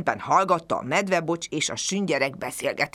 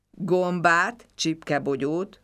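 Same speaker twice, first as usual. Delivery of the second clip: ordinary delivery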